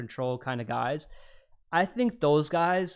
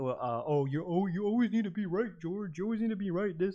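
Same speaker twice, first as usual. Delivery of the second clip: higher-pitched mimicking complaint; mock accusation; 4 semitones higher than usual. Man imitating people who confuse dominance, higher mocking complaint. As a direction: parrot a nagging accuser in a raised pitch.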